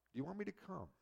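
soft, hushed question; quiet gentle offer. Pretend. Jesus softly offering to come to the centurion's house, hushed. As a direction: ask it gently, almost under the breath.

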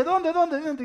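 high, loud panicked questioning; alarmed, high-pitched repeated question; startled awake. Acted. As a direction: leap up asking where, very loud. Samson wakes at alarm again.

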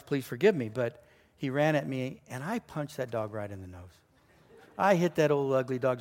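ordinary talk

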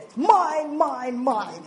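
high, loud first word then easing; mock toddler-like claiming; possessive whine. Mother clinging to her baby son, possessive.

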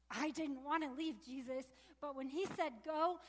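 normal talking voice

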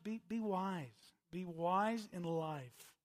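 slow, weighty urging; slow exhortation. Real, not acted. Preacher urging the congregation slowly to be wise. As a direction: lay each word down slowly.

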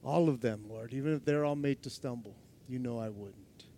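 starts quiet, sinks almost to nothing; fading vow. Pretend. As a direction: let the boast drain away into a murmur.

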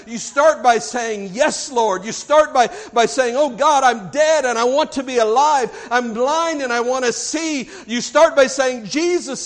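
forceful preacher building to a cry; impassioned preaching. Real, not acted. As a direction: preach it hard, driving every phrase home.

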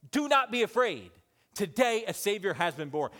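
high, ringing proclamation; proclaimed loudly. Shown as a demonstration. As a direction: shout the promise out to the crowd. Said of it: "I want you to hear these words".

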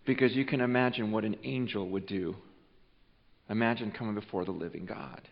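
soft, low, grave warning; quiet, earnest sermon intimacy; solemn hush. Acted.

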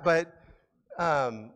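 ordinary delivery

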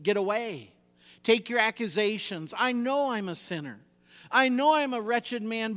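preacher's defiant dismissal; defiant rebuke. Acted. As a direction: send the accuser packing, voice lifted. Acted rebuke of Satan.

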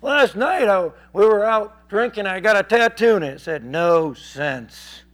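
loud, high boozy storytelling; excited heckler. Retold or imitated. Drinking guy shows preacher his tattoo, loud and excited. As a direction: blurt the tattoo story loudly. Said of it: "he says".

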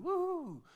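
high, loud "woo"; imitated yahooing cheer; celebratory whoop. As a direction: whoop like a jubilant crowd.

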